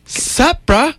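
high-pitched put-on bro voice; acted dude sauntering up, high and rising; mock bro greeting. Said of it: "the dude walks up and went".

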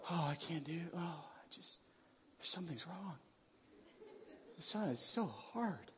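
very soft, feeble put-on moan; mock defeated. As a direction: sigh it out weakly, near a whisper. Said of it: "we go through pain and problems, we go".

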